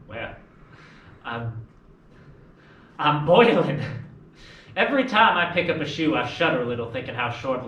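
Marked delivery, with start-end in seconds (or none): 3.0-3.8 pent-up outburst. Acted. Tom confesses his pent-up frustration.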